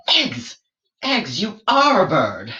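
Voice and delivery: very high jump on Eggs, then triumphant; surprised explorer. Acted. George hearing about the eggs, high astonished voice. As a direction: leap up in astonishment.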